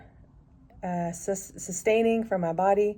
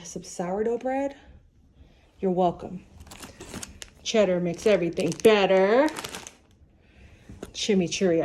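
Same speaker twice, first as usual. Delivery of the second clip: normal talking voice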